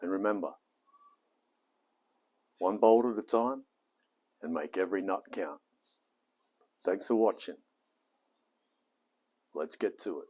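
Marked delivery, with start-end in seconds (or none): 0.0-7.6 upbeat presenter sign-off read; cheerful video host intro; YouTube intro. Acted. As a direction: pitch it like a channel host welcoming viewers. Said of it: "That, that was for YouTube".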